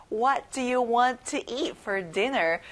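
very slow, carefully spaced question; slow model question. Shown as a demonstration. Teacher modelling a dinner question slowly. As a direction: read it slowly for repetition. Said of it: "같이 말해볼게요".

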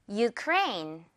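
"Ukraine" said yoo-KRAYN; model pronunciation. Shown as a demonstration. Teacher has learners repeat with the U sound, "Ukraine" as yoo-KRAYN. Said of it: "U 발음 기억하면서 듣고 따라해주세요".

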